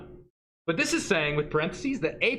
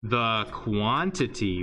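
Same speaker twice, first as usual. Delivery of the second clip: slow, weighty "the quantity", then long mock-awed "ooh"; mock official; 4 semitones lower, much slower than usual. Shown as a demonstration. Teacher offering official math wording, mock-grand delivery. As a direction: announce it grandly, with a drawn-out ooh. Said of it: "If you want to sound official".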